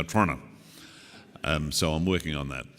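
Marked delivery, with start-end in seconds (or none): 0.0-0.4 Toronto with the vowels squeezed out, "Tronna"; local Toronto pronunciation. Shown as a demonstration. Speaker practising how they say it there, Toronto as clipped "Tronna". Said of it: "they remove all the vowels".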